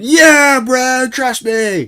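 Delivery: high, loud squealed insistence; silly friend voice. Retold or imitated. Imitating friend reassuring him about the car, silly high voice. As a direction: squeal it out, stretching the words. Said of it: "I don't know why I'm doing that voice".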